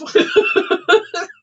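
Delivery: a shrieking high cackle. Real, not acted. Reader cackling at the cards' threat, up high. Laughing after burn line.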